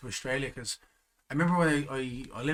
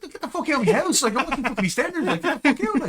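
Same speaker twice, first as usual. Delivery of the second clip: mock yell; 12 semitones higher, 6 dB louder than usual. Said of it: "I was like".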